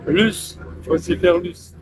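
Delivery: ordinary talk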